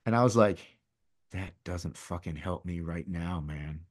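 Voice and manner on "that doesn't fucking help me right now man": muttered angry retort. Retold or imitated. Angry inner reply to friend.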